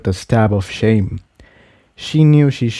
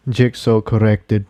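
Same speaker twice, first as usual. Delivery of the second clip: usual speaking voice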